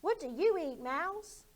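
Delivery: high, loud frog question; nosy frog asking; bouncy high frog voice; curious frog. Acted. Frog asks the mouse.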